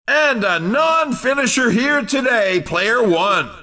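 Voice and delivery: usual speaking voice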